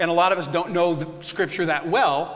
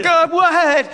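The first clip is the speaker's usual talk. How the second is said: high, loud startled yell; hammed-up incredulous shout; exasperated reply; 10 semitones higher, 8 dB louder than usual. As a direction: throw it back loudly at the one interrupting. Acting out startled reply to God.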